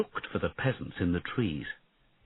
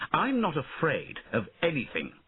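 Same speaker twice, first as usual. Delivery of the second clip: put-on boyish insistence; 8 semitones higher than usual. A narrator's reading. Boy prince insists.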